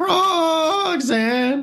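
long high crow wail of Roxanne; chirping bird person. Pretend. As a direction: hold the name out high like a bird cry. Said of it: "the crow call sounds like".

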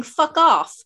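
the real meaning, spat out; blunt mock yell. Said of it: "it's just a kind way of saying".

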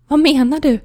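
high, loud frightened cry; panicked question. Retold or imitated. Patient panicking at the nurse, high frightened cry. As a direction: blurt it out in sudden panic.

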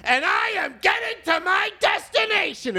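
high, slow, hammed-up shouting; indignant passenger. Passenger scolding a hijacker, shouting indignantly. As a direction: stress every word, rising in outrage. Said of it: "There would be some guy who's just like".

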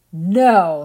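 loud, flat refusal; firm no. A narrator's reading. Invisible being's sister refusing the liars, firm loud no. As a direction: shut the lie down.

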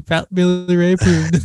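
slow, drawn-out tagline; sticker stamp voice. Pretend. Co-host imagining a cartoon sticker popping up, slow tagline voice. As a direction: stretch it like a pop-up stamp.

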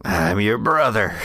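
low put-on old man's voice; older brother. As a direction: reveal it as a much older brother.

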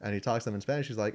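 ordinary talk, nothing marked

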